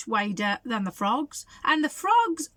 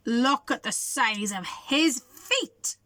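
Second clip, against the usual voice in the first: ordinary delivery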